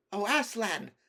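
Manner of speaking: high, loud, awed cry; excited cry. A narrator's reading. Edmund sees Aslan and darts forward, crying out. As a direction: cry the name out on sight. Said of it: "he cried".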